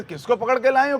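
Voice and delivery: high, loud indignant echo; चिढ़ी Gopi. Retold or imitated. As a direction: throw the question back in acted outrage. गोपी चिढ़कर सवाल दोहराती है. (ऊँची आवाज़ में चिढ़कर पलटा सवाल)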